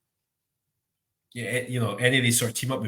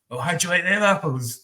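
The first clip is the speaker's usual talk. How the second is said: movie line impression; 7 semitones higher than usual. Friends teasing each other with the film line, in a gloating put-on voice. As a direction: quote "how do you like them apples" like the movie, gloating. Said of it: "we would just".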